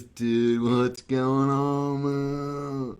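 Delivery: slow, drawn-out surfer dude; lazy beach-bum friend, stretched out slowly; laid-back surfer voice, dragged out slow. Retold or imitated. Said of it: "he talked like this".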